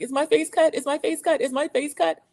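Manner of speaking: high, repeated anxious question; injured woman asking again and again in high panic; panicked. Retold or imitated. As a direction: voice her rising panic over her face. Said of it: "And she's like".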